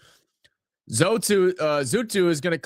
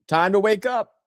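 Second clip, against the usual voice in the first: louder rally cry; 3 semitones higher than usual. Real, not acted.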